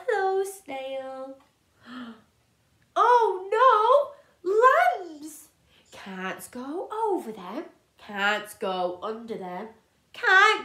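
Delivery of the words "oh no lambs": sudden high alarmed cry. Acted. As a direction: gasp it out in alarm. Alarm at lambs.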